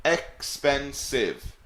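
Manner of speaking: Expensive drawn out slowly, ex-pen-sive; syllable demo. Shown as a demonstration. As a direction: stretch Expensive into three clear beats. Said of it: "How many syllables are in that word".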